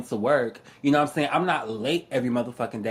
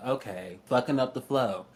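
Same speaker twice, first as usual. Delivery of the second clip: ordinary delivery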